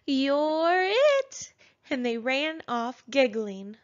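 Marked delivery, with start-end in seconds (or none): 0.1-1.5 put-on higher, louder gleeful child voice; baby dinosaurs tag Bumpy, gleeful high shout; playful cry. A narrator's reading. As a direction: shout it gleefully as you run. Siblings playing tag.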